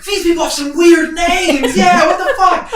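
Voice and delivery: high, laughing crowd shouts; screaming crowd. Acted. Crowd heckling odd names, high shouting. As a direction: yell like a rowdy tavern crowd, half laughing. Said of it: "the whole crowd is screaming".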